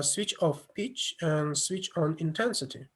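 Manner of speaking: normal talking voice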